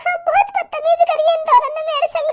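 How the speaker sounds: high falsetto, half crying; a hammed-up tearful girl accusing the boys; man voicing a weeping girl; wronged girl sobbing.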